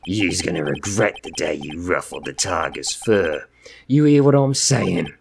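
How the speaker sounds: menacing Brooklyn gangster; threatening Brooklyn loan shark; Brooklyn accent. Acted. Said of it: "I know it's Brooklyn".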